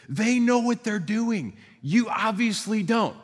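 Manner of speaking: high, slowed, pointed lifeguard retort, acted out; emphatic put-down. Retold lifeguard puts swimmer down.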